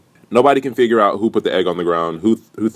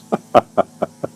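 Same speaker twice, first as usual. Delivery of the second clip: breathy giggling fit; amused laughter. Real, not acted. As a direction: laugh at his begging. Laughing at Raph's pleading.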